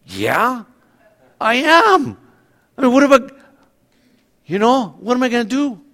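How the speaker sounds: admitting he is a sinner, high and resigned; shrugging admission. Retold or imitated. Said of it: "I'm like".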